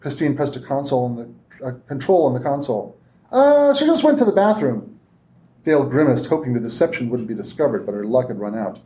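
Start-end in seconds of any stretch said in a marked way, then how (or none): 3.3-5.0 nervous lie. Acted. Christine lies to captors.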